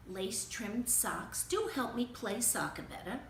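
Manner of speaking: usual speaking voice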